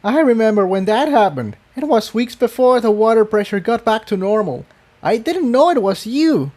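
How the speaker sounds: amused Tiptoe. Acted. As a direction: marvel at the memory. Tiptoe recalls the flood.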